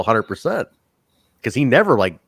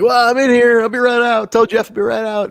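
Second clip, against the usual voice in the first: mock high nervous call; 12 semitones higher, 4 dB louder than usual. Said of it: "he's like".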